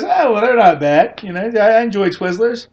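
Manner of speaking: a casual friendly reply; photographer. Retold or imitated. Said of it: "And he goes".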